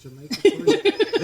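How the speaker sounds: high, loud and slow; excited. Real, not acted. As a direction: announce it with glee. Excited announcement.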